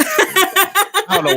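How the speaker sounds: high laughing squeal. Real, not acted.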